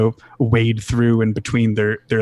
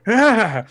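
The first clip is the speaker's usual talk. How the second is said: high-pitched cry of alarm; startled yelp; 11 semitones higher than usual. Acted. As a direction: yelp as if something flew at you. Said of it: "puts his hands up as this mouse flies".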